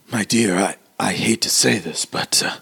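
put-on hesitant old man, halting with long pauses; lightly put-on elderly gentleman voice. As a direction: make an awkward, delicate request as the old man. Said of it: "the old dude".

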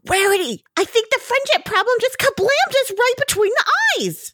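high, bright cartoon pony exclaiming; Pinkie Pie. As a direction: squeal the news to Rarity. Pinkie Pie voiced in recap.

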